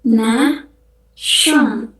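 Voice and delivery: "Nation" as naʃon, falling; French pronunciation. Shown as a demonstration. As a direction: repeat "Nation" French-style. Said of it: "kita ngomongnya". (ucapkan "Nation" ala Prancis)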